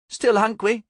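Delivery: put-on high, babyish mispronounced words; toddler voice. A narrator's reading. Toddler reminding her father she wants food, babyish. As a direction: pipe it up as a small child. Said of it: "he picked up the toddler".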